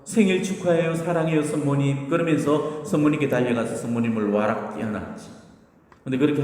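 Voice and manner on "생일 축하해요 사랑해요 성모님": put-on higher-pitched, happy child's greeting; mildly acted delight, voice lifted; overjoyed. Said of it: "야곱은 너무 좋아서".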